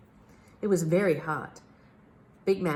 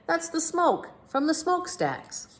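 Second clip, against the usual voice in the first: much higher, slow captain voice; amused captain; 11 semitones higher than usual. A narrator's reading. Captain dismissing the detective's worry, amused. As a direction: brush him off with a chuckle.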